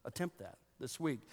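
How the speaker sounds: ordinary talk, nothing marked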